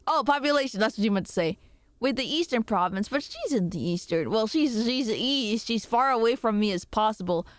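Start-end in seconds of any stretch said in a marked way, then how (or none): none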